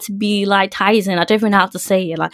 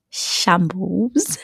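quiet, rising; 6 dB quieter than usual. Acted. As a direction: let it trail up quietly. One-word verdict, two takes.

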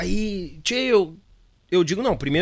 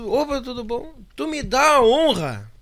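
imitating a fan; 7 semitones higher than usual. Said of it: "E ele".

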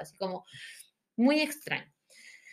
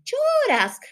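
exclamación aguda y fuerte; burla desafiante; 7 dB louder than usual. Retold or imitated. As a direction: soltarlo alto, con sorna. Mocks the defiant girls. (burla gritada y aguda)

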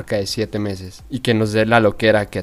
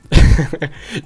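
risa fuerte que interrumpe; carcajada; 5 semitones higher, 7 dB louder than usual. Real, not acted. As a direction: estallar en risa. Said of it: "se le sale la risa".

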